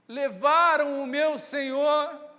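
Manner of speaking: loud, slow cry of loss; desperate plea. Acted. Mary telling the gardener, slow desperate cry.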